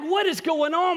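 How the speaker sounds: baffled and desperate to know; frantic disbelief. Retold or imitated. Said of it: "And so I'm like".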